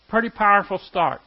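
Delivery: ordinary talk, nothing marked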